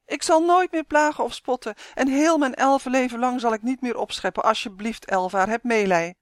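wailing plea. A narrator's reading. Yves promising Elvaar to behave, wailing for pity. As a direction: beg for mercy with promises. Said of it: "jammerde hij".

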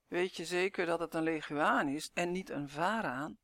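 plain reading voice

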